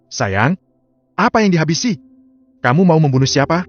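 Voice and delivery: a wife's startled, rising challenge; alarmed wife. Acted. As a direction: demand who he means to kill. Wife confronts her husband.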